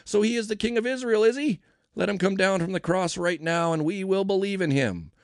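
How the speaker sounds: high-pitched, sneering mockery; scoffing. A narrator's reading. Religious leaders mocking Jesus, scoffing. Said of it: "they scoffed".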